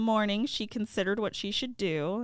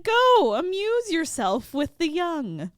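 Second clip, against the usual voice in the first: loud, high, grand send-off; high exclaimed 'Go!', then easing slightly; mother urging; 9 semitones higher, 7 dB louder than usual. Acted. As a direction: shoo a daughter off to the party. Mother sends daughter to the gathering.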